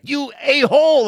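high-pitched yelled insult, retold. Radio host re-enacting yelling at a coughing man, high yell. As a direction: yell it high, as if calling him out. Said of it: "not only did I yell".

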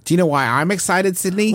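high, teasing excitement; excited. Real, not acted. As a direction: tease her with eagerness.

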